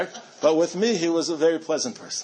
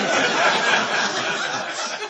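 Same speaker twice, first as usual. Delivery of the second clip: bright burst of laughter; 6 semitones higher than usual. Real, not acted. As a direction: laugh out at the memory. Laughs recalling the smile.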